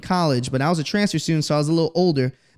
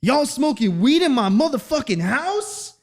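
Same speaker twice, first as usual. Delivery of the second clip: mock dad yelling; 10 semitones higher than usual. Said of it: "woke up from my dad yelling".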